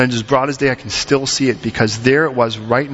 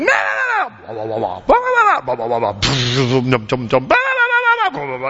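mock yelling match. Couple quarrelling all the way to the car, mock screech and grumble. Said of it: "chase him around and yell at him".

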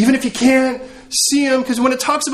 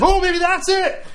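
high, drawn-out hopeful gasp of discovery; mock awed realization; 10 semitones higher, slower than usual. Disciple mistakes a stomach rumble for power, mock wonder. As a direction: act out slow, high-pitched wonder.

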